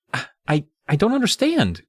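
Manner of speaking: rising high, choking out the words; a frightened girl barely choking it out; choked out. A narrator's reading. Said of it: "managed to choke out".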